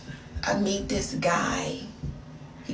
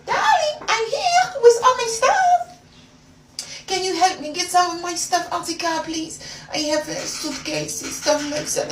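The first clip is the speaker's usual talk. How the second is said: put-on high sweet French accent; 10 semitones higher than usual. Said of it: "I spoke in his French accent".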